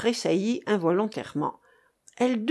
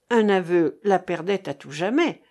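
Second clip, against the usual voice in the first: usual speaking voice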